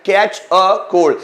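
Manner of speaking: teacher stretches out Catch a cold so students repeat it; slow, clear demo. Shown as a demonstration. Teacher drilling students on a phrase, very slow and clear. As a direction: Catch a cold said very slowly, each word spaced apart. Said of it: "क्या verb है?".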